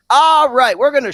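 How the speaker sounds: rally the audience for a new topic; loud transition. Real, not acted. Podcast host grabs listeners' attention to switch topics, loudly.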